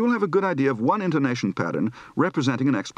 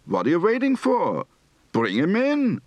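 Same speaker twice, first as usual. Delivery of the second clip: higher, insistent Yiddish lilt; urgent Yiddish intonation; 7 semitones higher than usual. Shown as a demonstration. Teacher demonstrates impatient Yiddish tune, higher. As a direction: push it up and urge them. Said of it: "Recite the following practice phrases".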